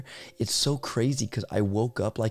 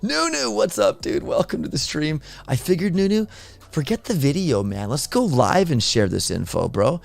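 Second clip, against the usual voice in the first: excited; 7 semitones higher than usual. Real, not acted. Excited greeting of a chat friend.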